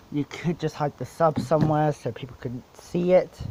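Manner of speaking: usual speaking voice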